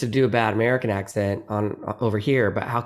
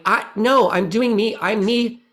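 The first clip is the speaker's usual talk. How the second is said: high-pitched, emphatic protest; indignant comeback pitched well up; insistent retort; 11 semitones higher, 4 dB louder than usual. Retold or imitated. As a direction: push back, insisting on yourself. Acted retort to those people.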